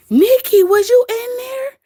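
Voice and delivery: high, loud call to Nikki; excited surprise. Real, not acted. Asking a viewer if she was there, excited surprise.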